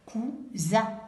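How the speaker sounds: ordinary talk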